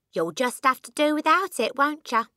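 high-pitched offhand wifely retort; dismissive wife. A narrator's reading. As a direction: brush off your husband's complaint. Wife dismissing husband's complaint.

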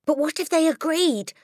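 high, loud eager question; bursting with a sudden hope; eager cry. A narrator's reading. Matilda eagerly asks her teacher. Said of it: "Matilda cried eagerly".

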